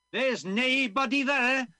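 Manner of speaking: put-on high, loud, slow Scottish old passerby; Scottish accent. Old passerby tells the visitor the doctor's gone, Scottish accent. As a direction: call it out like an old Scots villager. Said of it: "I'm gonna be doing a Scottish accent".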